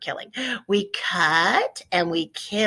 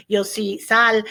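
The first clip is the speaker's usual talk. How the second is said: normal talking voice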